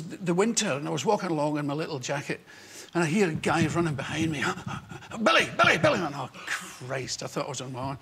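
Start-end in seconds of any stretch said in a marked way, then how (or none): 5.2-6.0 high shouted name; excited fan calling his name. Retold or imitated. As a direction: call after him from behind. Fan calling after him on beach.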